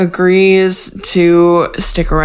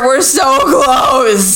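whiny; 6 semitones higher, slower than usual. Real, not acted. Impatient mock whine.

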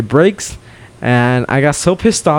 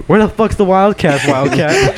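retold screaming; 8 semitones higher than usual. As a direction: re-enact yelling out to an idol, pitched high. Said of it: "And I'm screaming".

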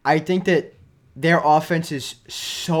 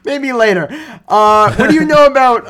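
higher, louder mock reporter voice; raised, insistent press-room question; imitated reporters; 7 semitones higher, 8 dB louder than usual. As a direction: mimic a reporter brushing it off and pressing on. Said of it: "And then they go".

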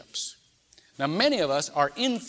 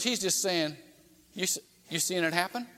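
usual speaking voice

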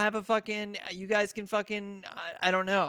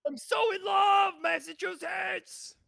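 mock Boston sports-fan shout, much higher and louder; bad Massachusetts accent; 10 semitones higher, 4 dB louder, much slower than usual. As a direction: yell like a drunk Red Sox fan in a bad Massachusetts accent. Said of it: "That's not even a good Massachusetts accent".

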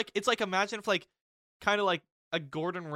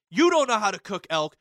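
louder retold chef scolding; mock angry chef; 5 semitones higher, 10 dB louder than usual. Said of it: "he goes".

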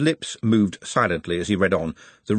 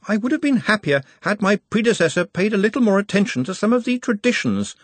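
lightly voiced careful young wizard, higher; hint of a nervous assistant; Ponder, cautious; 12 semitones higher than usual. A narrator's reading.

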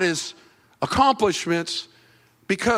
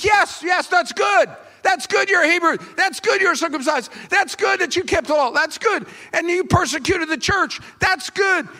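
high, excited cheering on; mock eager approval; 11 semitones higher than usual. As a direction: cheer them on like zealous religious leaders. Preacher voices approving religious leaders.

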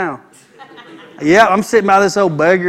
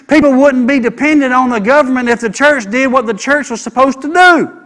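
high, loud pulpit preaching; fiery sermon climax; preaching at full force; 7 semitones higher, 7 dB louder than usual. Acted. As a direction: drive every line home to the congregation.

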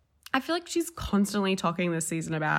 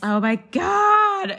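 drawn-out, high, loud oh my God; overwhelmed by the absurdity; slow and loud; shocked gasp; 12 semitones higher, 9 dB louder, much slower than usual. Real, not acted. Shocked at co-host's joke.

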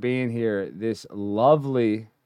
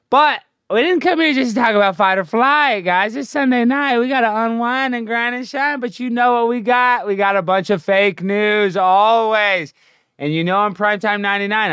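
much higher, hyped-up bragging; 10 semitones higher, 4 dB louder than usual. Acted. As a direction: pump up the crowd about the show. Hyping himself as newscaster.

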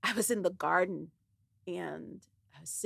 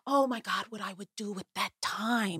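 quiet whisper; 7 dB quieter than usual. Retold or imitated. Friend marvels at the time left.

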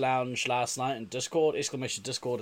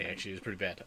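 normal talking voice

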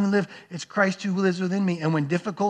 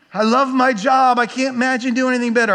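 loud, higher, gushing start then rushing on; mock enthusiasm; 4 semitones higher, 5 dB louder than usual. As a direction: gush as if imitating someone thrilled. Mimicking fickle job enthusiasm.

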